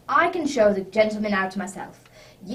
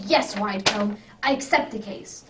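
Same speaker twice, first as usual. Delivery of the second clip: ordinary talk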